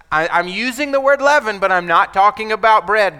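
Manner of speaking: Jesus correcting confused disciples; emphatic correction. Acted. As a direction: insist on it.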